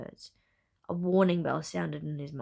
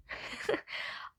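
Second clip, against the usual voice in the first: soft, breath-only chuckle; low-volume chuckle of surprise; surprised chuckle; 9 dB quieter than usual. A narrator's reading. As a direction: let out a short, quiet laugh. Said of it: "let out a surprised chuckle".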